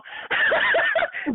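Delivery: shrieking high laugh. Real, not acted. Laughing at the supervisor quitting, high shriek.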